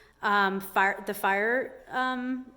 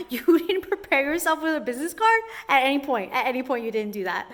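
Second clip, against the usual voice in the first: louder, higher scolding; exasperated mock scolding; 4 semitones higher, 5 dB louder than usual. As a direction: scold him for dawdling. Scolding the dawdling inspector.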